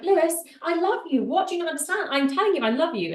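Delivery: high, loud, pleading insistence; hammed-up frustration at not getting through; acted exasperation. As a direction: push each phrase up, loud and insistent. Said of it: "I'm like".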